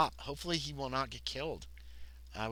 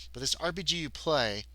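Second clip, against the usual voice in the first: usual speaking voice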